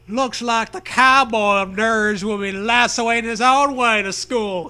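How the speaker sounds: a hint of a jeering bully driver; mocking bus driver. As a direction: sneer it at the kid left behind. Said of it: "he guffaws".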